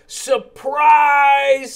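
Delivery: loud high joyful shout. Acted. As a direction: burst out with the surprise. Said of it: "said Lowly".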